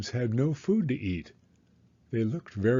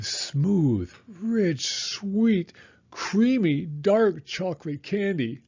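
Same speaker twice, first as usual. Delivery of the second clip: higher, drawn-out dreamy longing; savouring; 5 semitones higher, slower than usual. A narrator's reading. Narrator tempted by the chocolate, dreamy and savouring. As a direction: linger on each word like tasting it.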